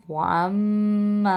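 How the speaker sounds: وَأَمَّا held long and loud, three beats; three-count madd. Shown as a demonstration. Teacher showing the length of the madd. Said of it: "مد کو کھینچ کے پڑھیں گے".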